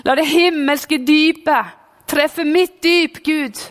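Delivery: slow, lifted prayer plea; pastor crying out in prayer. Retold or imitated. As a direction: cry out to God like the revival pastor. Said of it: "så bad han ut".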